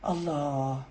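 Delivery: low, very soft murmur; hushed reverence. Real, not acted. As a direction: let it out softly in devout appreciation. Savours the hadith just recited.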